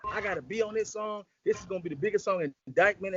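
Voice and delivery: high-pitched retold insistent rant; going off. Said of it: "She's going off".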